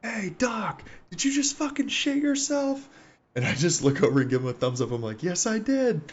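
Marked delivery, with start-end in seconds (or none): 0.0-2.9 high, strained mock holler; imitated yell. Gunner yells over to the medic, high mock holler. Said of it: "he yells at me". 5.2-6.0 high-pitched shouted answer, imitated; mock call back. Medic answers the yelling gunner, high mock holler.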